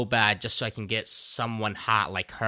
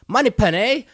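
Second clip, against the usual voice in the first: mock British boss; 11 semitones higher, 7 dB louder than usual. Voicing M in a retold scene.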